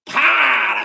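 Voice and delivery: fishmonger's whack. Retold or imitated. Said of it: "she's like".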